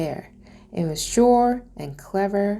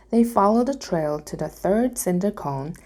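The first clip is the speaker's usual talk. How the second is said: ordinary delivery, nothing marked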